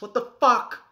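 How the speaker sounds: sudden loud, high burst; exasperated outburst. Acted. Tired parent losing it at the child, loud outburst. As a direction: snap at a kid who won't sleep.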